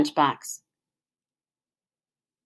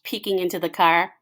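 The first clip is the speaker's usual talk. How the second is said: usual speaking voice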